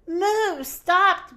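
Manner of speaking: high, loud yell to stop the bus. Acted. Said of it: "Minnie yelled".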